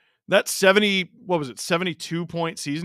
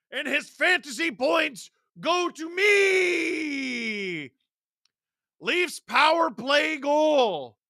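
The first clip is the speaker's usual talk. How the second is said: high shouted play-by-play with drawn-out words; excited goal call; 11 semitones higher, 6 dB louder, much slower than usual. Real, not acted. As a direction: stretch the words in a soaring celebration of the goal. Calls a live goal, celebrating.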